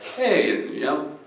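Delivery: high-voiced mock mail lady. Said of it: "So the mail lady".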